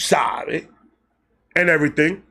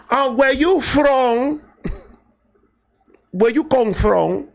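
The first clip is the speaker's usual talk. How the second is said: put-on sweet accented cooing, syllables stretched; imitated sweet talk; 8 semitones higher, much slower than usual. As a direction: imitate girls sweet-talking, slow and high. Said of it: "they were all, like, sweet talk".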